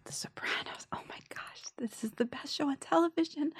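hushed, whispered awe, rising into a high, soft voice; breathless-with-awe whisper to yourself; awed whisper. Retold or imitated. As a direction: whisper it like a thrilled private thought. Awed thought on getting cast.

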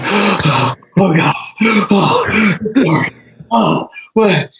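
high, gasping mock panic; panicked panting. Scared diver over the mic, panting in panic. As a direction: imitate frantic panting between broken words. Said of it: "he's just panting, you know, profusely over the mic".

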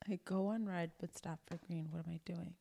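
hushed riddle read. Shown as a demonstration. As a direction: read it quietly, almost whispered.

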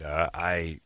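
ordinary talk

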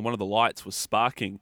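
usual speaking voice

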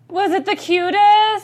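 high, loud, drawn-out gushing; gushing squeal. Real, not acted. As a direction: squeal it slowly over something adorable. Gushing over cute episode.

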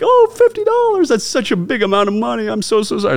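hammed-up high naive gush; mock dumb American. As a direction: squeal it like the clueless tourist, overplaying the shock. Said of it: "And so I'm like".

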